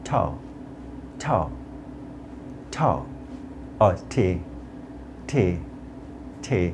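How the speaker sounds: "ঠাও" and "টি" with a puffed T, spaced out; aspirated T drill. Shown as a demonstration. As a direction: release air on each T of "ঠাও" and "টি". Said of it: "এই লেটারটাকে উচ্চারণ করতে হবে". ("ঠাও", "টি"-তে হাওয়া ছেড়ে মহাপ্রাণ ট)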